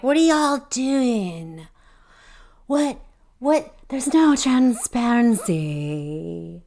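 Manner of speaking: slow, drawn-out mock of a councilwoman; mocking Paula. Podcaster mocking Paula Hoisington at the meeting, slow and drawn out. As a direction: stretch each word as if scolding the council. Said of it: "So she talks".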